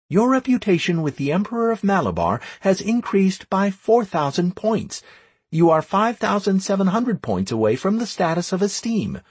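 brisk high-pitched proclamation; game system announcement. Acted. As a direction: rattle off the notification on a high, level pitch.

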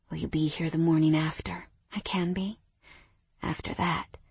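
hushed sleepy whisper. Acted. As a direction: breathe the words in the dark. Sleepy lovers whispering at night.

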